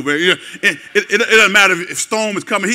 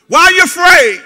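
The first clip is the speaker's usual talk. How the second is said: higher, louder, pressing; loud challenging question; 10 semitones higher, 9 dB louder than usual. Retold or imitated. Preacher voicing Jesus challenging disciples, louder and higher. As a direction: fire it back at the scared crowd. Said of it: "The master asked".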